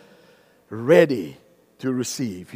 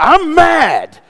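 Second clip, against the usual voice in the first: mock anger; 14 semitones higher, 15 dB louder than usual. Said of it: "come to me and say to me".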